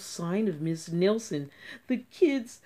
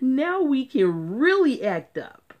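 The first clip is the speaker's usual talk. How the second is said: loud, higher mischievous glee; gleeful; 6 semitones higher, 9 dB louder than usual. Acted. Kids gleefully planning mischief. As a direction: gloat like naughty kids.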